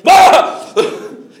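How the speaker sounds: loud high laugh at the memory; burst of laughter. Real, not acted. Preacher remembering his dad's snake joke, laughing out loud. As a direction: laugh out at the prank.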